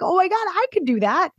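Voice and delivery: high, thrilled discovery; mock amazed realization. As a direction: play the client who suddenly gets it. Client realizing it's possible.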